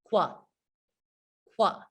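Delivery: qua with a kw start; QU sound. Shown as a demonstration. Teacher modelling the QU sound for learners. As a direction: clip out qua. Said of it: "they say".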